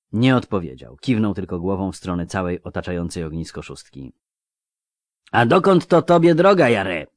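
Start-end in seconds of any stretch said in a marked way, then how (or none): 5.3-7.1 high, loud, chummy question; young man's voice. Acted. Young robber asking an old schoolmate's destination, loud and high. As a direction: ask nosily in a raised youthful voice.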